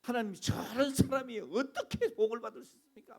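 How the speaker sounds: whining to God half in jest; mock complaint to God. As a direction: play a self-righteous believer with a laugh. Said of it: "우리가 하나님 앞에 뭐라 그래요?".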